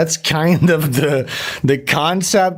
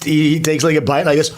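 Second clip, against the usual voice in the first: ordinary delivery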